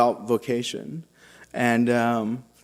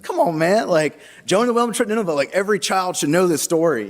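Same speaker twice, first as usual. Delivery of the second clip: higher-pitched, incredulous chiding; mock exasperation; 6 semitones higher than usual. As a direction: quote a friend's exasperated protest. Deacon chides his puzzlement in game.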